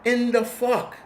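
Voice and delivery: disbelieving, voice shooting up; incredulous. Retold or imitated. Reacting to OnlyFans earnings, high incredulous. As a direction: pitch leaps high on in the fuck. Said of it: "And I'm like".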